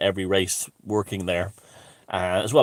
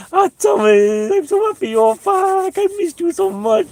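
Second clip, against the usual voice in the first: high, squeaky put-on granny voice; Chinese granny impression; 8 dB louder than usual. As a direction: coo it like a doting granny who missed him. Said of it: "she was like".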